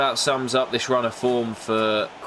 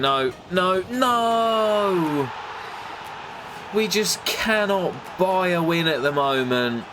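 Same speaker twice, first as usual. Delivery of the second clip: rising, higher cry of despair; real despair, then a pained higher lament; anguished no; 8 semitones higher, slower than usual. Real, not acted. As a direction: groan no, no, no as the late goal goes in. Late winner conceded, anguished cry.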